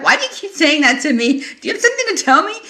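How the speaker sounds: loud, high-pitched retold confrontation; exasperated challenge. Confronting a friend over repeated jibes, loud and exasperated.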